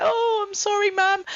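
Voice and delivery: high-pitched imitation of son; son apologizing. Son says sorry after mum cracked it, imitated high child voice. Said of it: "he was like".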